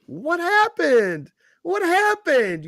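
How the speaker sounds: high, drawn-out gloating question; sing-song I-told-you-so jeer; mocking taunt. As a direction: stretch it out high, rubbing it in. Taunting a failed company in story.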